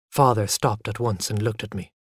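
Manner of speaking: usual speaking voice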